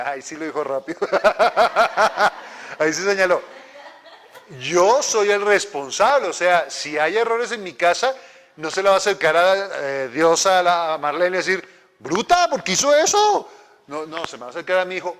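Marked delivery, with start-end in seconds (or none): 1.0-2.7 real burst of rhythmic laughter; hearty laugh. Real, not acted. Preacher amused by a quick answer from the congregation, hearty laugh. Se ríe de una respuesta rápida. 12.0-13.5 loud, high-pitched indignant rebuke; hammed-up outraged telling-off; mock scolding. God imagined scolding the preacher's wife, mock outrage. Dios regañando a la esposa, imaginado.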